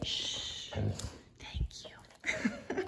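whispered shush and thanks; very soft, breath-only whisper; inside voice. Real, not acted. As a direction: hush the dog, then whisper thanks. Teaching dog an inside voice.

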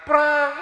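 a sharp, high crash sound; quick, high crashing sound; mimicked plate crash. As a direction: smash the plate with your voice. Plate thrown down again.

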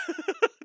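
very high squealing laugh. Real, not acted. Laughing about the booze.

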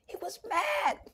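breathy hushed whisper, high and soft. Acted. Preacher to his listeners, whispering the line. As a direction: drop it to a secret whisper.

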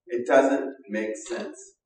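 very slow, rising on "doesn't"; slow emphasis. Shown as a demonstration. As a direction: spell it out word by word. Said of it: "repeated line, slow emphatic".

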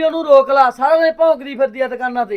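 loud, high yelled quote; angry shouting. Acted. Brother shouting what his wife told him, furious. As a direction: fling the wife's words at her.

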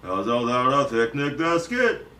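sing-song cartoon bear voice; Yogi Bear. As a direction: talk like a jolly cartoon bear, rising at the end. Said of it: "I can do Yogi Bear".

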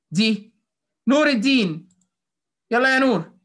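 normal talking voice

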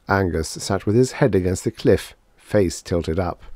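plain reading voice

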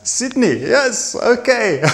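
high, sing-song Australian twang; chirpy put-on Aussie accent, laughing at the end; mock Australian accent. Touring Sydney, greeting the locals in a hammed-up Australian accent. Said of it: "And then we go to Australia".

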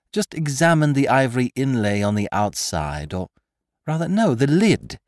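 Lupin's voice. Acted. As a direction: needle the prisoner with sly amusement. Lupin questioning Marco.

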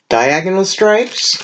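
ordinary talk

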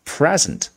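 PREsent, high on the first syllable of present; 第一音节重读. Shown as a demonstration. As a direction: say present front-stressed, falling away. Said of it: "重读在第一音节啊". (present前音节高而重)

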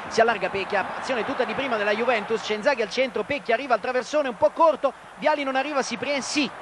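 rising, breathless play-by-play call; breathless italian goal commentary. Real, not acted. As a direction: build the call as the attack arrives. Attack builds, voice climbing.

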